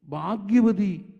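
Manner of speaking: loud, slowly stretched preacher's cry; emphatic proclamation. Retold or imitated. Preacher quoting prophecy to the congregation, loud slow proclamation. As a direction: proclaim it loudly, drawing out the word.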